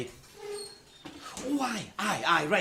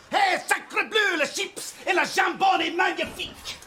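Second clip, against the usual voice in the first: mock French accent; 10 semitones higher than usual. Chef's joke French.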